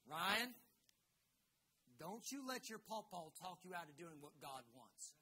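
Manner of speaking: imitated Southern nana, the name called out loud and rising; thick Southern accent, grandma laying down advice; major Southern accent. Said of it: "major Southern accent".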